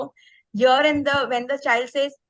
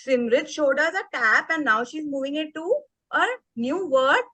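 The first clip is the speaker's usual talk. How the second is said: ordinary talk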